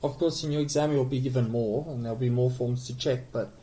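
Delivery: ordinary talk, nothing marked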